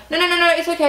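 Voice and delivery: high, loud mock protest; flustered refusal. Passenger turning down flight attendant's help, flustered. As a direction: wave off help in a high flurry.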